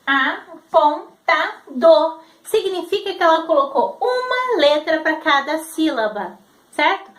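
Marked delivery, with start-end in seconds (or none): 0.0-2.2 put-on each syllable drawn out, like a child sounding it out; slow syllable reading. Shown as a demonstration. Teacher showing how the child reads it, slow and spaced. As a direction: read it slowly syllable by syllable. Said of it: "como que ela vai ler?".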